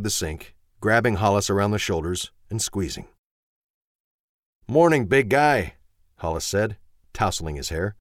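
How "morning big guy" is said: warm. A narrator's reading. Father greets young son.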